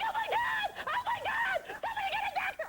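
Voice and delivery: very high, frantic cries for help; hysterical panic. Retold or imitated. [name] panicking as a castmate falls offstage, shrieking high. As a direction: shriek like the world is ending. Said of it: "I start hysterical crying".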